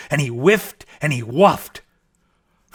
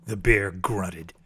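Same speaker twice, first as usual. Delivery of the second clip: plain reading voice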